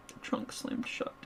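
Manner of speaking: usual speaking voice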